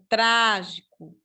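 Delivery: "trágico" slowly, with a long, strong first syllable; stress on TRÁ. Shown as a demonstration. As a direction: lean hard on the "trá" of "trágico". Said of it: "A sílaba tônica está aqui". ("trágico" com a sílaba tônica "trá" bem marcada)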